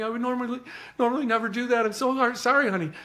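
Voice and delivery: high-pitched, mildly distraught apology; breaking down. Retold or imitated. Mother explaining herself to a stranger, imitated breakdown. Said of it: "she breaks down".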